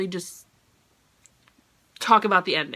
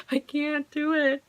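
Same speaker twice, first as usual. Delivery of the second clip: choked-up whimper; 7 semitones higher, slower than usual. Retold or imitated. Overwhelmed at the book's ending.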